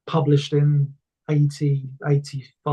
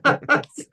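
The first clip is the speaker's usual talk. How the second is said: laugh; 11 semitones higher than usual. Real, not acted. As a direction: burst of high laughter. Laugh after party memory.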